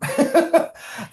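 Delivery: a quick laugh up high; laughing at his own joke; high burst of laughter. Real, not acted.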